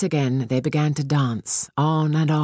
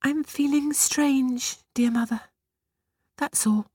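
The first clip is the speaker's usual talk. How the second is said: tearful; 11 semitones higher than usual. A narrator's reading. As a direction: hold back tears as you say it. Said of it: "eyes brimming with tears".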